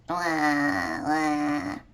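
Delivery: imitated low, drawn-out baby wail; baby's wail. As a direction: wail "wah" like a newborn sister. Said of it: "my little sister was saying".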